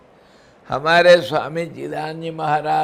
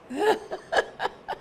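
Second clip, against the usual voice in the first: squeaky high burst of laughter; high giggle; 5 dB quieter than usual. Real, not acted. Preacher mocking fake gurus, high giggle. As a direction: giggle at the joke.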